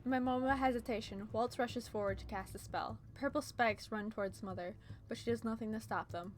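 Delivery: usual speaking voice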